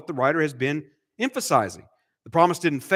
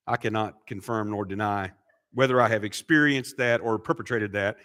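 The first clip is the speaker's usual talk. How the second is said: ordinary talk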